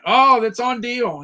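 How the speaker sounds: ordinary delivery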